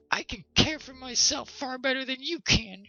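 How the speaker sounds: high, loud snapping retort; snapping wife. A narrator's reading. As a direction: snap back at him, insulted. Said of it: "she snapped back".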